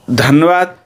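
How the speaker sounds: normal talking voice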